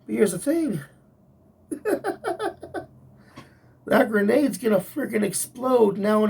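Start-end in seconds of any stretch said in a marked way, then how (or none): 1.7-2.8 short high-pitched laugh; a quick laugh up high. Real, not acted. Vlogger picturing the blowup, a short high laugh.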